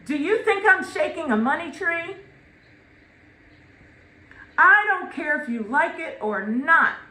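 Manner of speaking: loud, fast, scolding mother; scolding mom. Retold or imitated. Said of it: "Ruby would actually say".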